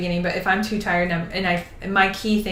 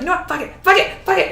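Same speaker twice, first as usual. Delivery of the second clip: mock tantrum climbing high as it repeats; toddler tantrum; 10 semitones higher, 3 dB louder than usual. Reader retelling giving up on a book, mock toddler tantrum. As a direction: act out a childish refusal, pitch shooting up. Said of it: "like, a toddler tantrum".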